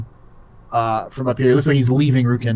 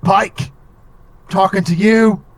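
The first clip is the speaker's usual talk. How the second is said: high, loud bugbear challenge; drunken Cockney bugbear; 6 semitones higher, 4 dB louder, slower than usual. Acted. Drunk bugbear singles out Pike, high loud challenge. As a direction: call someone out, louder and higher. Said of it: "He goes".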